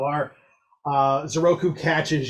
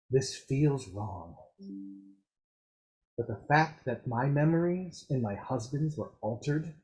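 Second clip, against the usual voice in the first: softer, lower, grave voice; a worried woman confiding her doubts; Norshella, troubled; 4 semitones lower, 7 dB quieter than usual. Acted.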